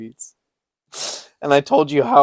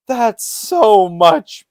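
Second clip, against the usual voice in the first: slow relieved quote; 10 semitones higher, 6 dB louder, much slower than usual. Retold or imitated. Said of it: "and I'm like".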